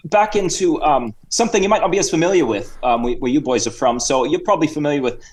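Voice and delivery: chatty put-on Cockney-Australian accent; Sir Reginald. Guest introduces the hosts to sport in fake Cockney-Australian accent. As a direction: ramble as a mock Cockney-Aussie guest. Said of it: "bit of a Cockney accent and Australian".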